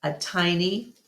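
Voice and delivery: plain reading voice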